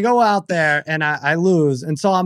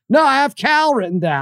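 higher, matter-of-fact mock host; imitating Jackie Slater; 9 semitones higher than usual. Retelling the host's excuse in a higher mock voice. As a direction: shrug it off like a host reading notes. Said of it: "He's like".

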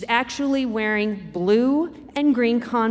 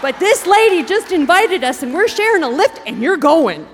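louder, firm insistence; insistent loud mom; 7 semitones higher, 8 dB louder than usual. Retold or imitated. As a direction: lay down the law to the kid. Mom insisting to her son.

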